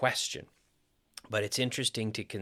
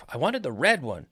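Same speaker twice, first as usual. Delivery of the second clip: RED high and emphatic, correcting; stress on RED; 4 semitones higher than usual. Shown as a demonstration. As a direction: lift RED sharply, then fall. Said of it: "indicating that it wasn't the blue one".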